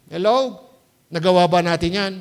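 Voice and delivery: ordinary talk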